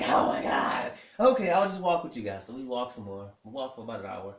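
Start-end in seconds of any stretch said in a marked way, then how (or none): 0.0-2.5 loud acted outburst, pitch climbing; exasperated giving-in. Husband scolded into more walking, exasperated. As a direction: cry out in mock exasperation, then give in loudly.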